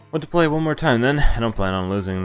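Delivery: ordinary talk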